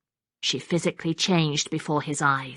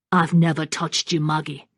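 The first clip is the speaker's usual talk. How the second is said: ordinary delivery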